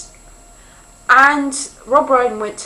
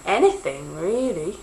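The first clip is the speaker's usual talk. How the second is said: normal talking voice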